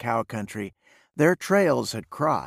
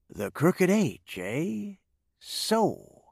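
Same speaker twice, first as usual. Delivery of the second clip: slow drawl, lifting in pitch; 7 semitones higher, 3 dB quieter, much slower than usual. A narrator's reading. Breen mulling over the Crooked H, drawling. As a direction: draw the words out lazily. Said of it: "Mark Breen drawled".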